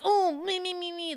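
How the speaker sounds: high-pitched mocking whine; mock tantrum. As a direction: mimic a childish snapping fit. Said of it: "when they just snap".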